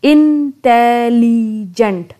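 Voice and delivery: "इंटेलिजेंट" very slow, broken into four syllables; slow syllable split. Shown as a demonstration. Teacher sounding out a four-syllable word for students. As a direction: drag each syllable out one by one. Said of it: "इसके अंदर चार syllables पाए जाते हैं".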